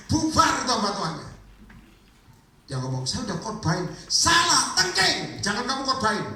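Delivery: high-pitched revival preaching; fiery preaching. Acted. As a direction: preach hard and fast, rising to a peak. Preaching at full force.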